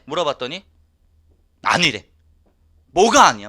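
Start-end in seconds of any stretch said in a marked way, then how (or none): 2.9-3.5 다그치는 외침. Retold or imitated. 아내에게 따지는 부부 싸움.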